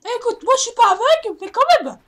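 loud teasing yell. Real, not acted. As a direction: shout at your teammate. Yelling at teammate over misses.

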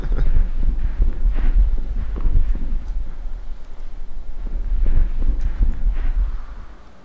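long wheezing unvoiced laugh; helpless silent giggling fit; breathless laughter. Real, not acted. Laughing at his own stutter.

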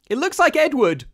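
high, loud panicked outburst; frenzy of fear. A narrator's reading. Rebecca seeing her dead brother's likeness, frantic with fear. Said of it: "burst out Rebecca in a sort of frenzy of fear".

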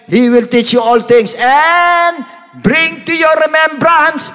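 high, loud, drawn-out preaching; full-force preaching. Acted. Preacher tells the congregation about the Holy Ghost's power, full-force preaching. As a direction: preach it hard, pitch pushed high.

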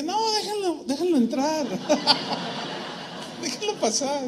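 high, laughing take on his own calm order; hammed-up fearless pastor; mock brave pastor. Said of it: "Pero era todo serio". (imitando al pastor valiente, más agudo y riendo)

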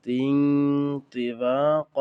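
তিন তিবাক loud with drawn-out nasal; heavy ghunnah. Shown as a demonstration. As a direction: hold the nasal of তিন, then stretch তিবাক. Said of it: "এভাবে আমরা প্রত্যেকটা". (তিন তিবাক-এ জোরে মোটা গুন্না)

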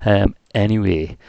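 ordinary delivery

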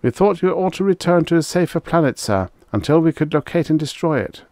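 high-pitched, urgent military report; reporting officer. Acted.

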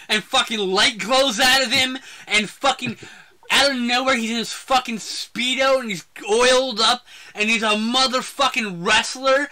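worked-up fan retelling at full tilt; excited rant. Real, not acted. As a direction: rave about it with every swear punched. Raving about absurd movie climax.

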